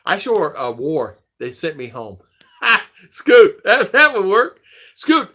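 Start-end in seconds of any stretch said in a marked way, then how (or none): 2.6-5.3 high, loud excited burst; excited laugh. Real, not acted. Remembering a way out, excited and loud. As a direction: blurt it out laughing.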